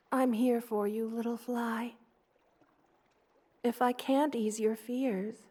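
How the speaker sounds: ordinary delivery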